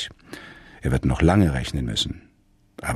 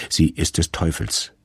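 ordinary delivery, nothing marked

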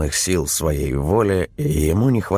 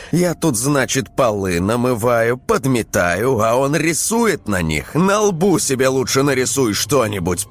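angry scolding wife; 8 semitones higher than usual. A narrator's reading. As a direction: tell him off, outraged.

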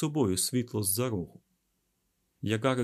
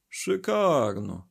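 slow, drawn-out sarcasm; dry mock admiration; ironic; 3 semitones higher, 4 dB louder, much slower than usual. A narrator's reading. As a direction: stretch it out mockingly. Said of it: "іронічно сказав він".

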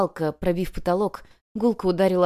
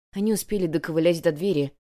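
ordinary delivery, nothing marked

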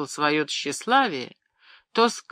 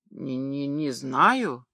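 frightened stammer; 3 semitones lower, much slower than usual. A narrator's reading. Boy answering his furious master, scared stammer. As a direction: shrink away and barely get it out. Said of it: "пролепетал подросток".

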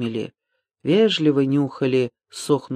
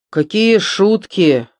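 raised, slow sighing retort; hungry sigh; 5 semitones higher, 5 dB louder, slower than usual. A narrator's reading. Hungry Голован dismisses talk of jokes, sighing. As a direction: sigh it out slowly and loudly. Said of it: "с голодным вздохом".

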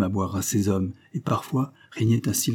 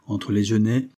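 plain reading voice

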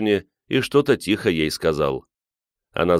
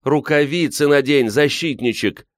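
high, agitated reproach; exasperated scolding; 9 semitones higher than usual. A narrator's reading. Woman scolding the man who burnt himself, exasperated. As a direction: chide the clumsy helper sharply. Scolding during bear alarm.

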